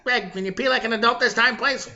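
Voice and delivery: high, Tony Clifton-like voice; Boston coworker impression. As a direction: imitate the coworker pestering someone. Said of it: "the guy talked kinda like Tony Clifton".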